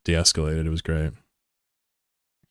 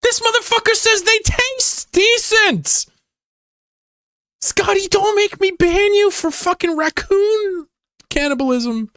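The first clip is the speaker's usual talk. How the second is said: a slow, squeaky voice pitched far higher, outraged; scolding a viewer in a tiny cartoon voice; outraged squeak; slower than usual. Real, not acted. Streamer scolding Scotty over eating raccoons, outraged squeak. Chat member says raccoons taste decent.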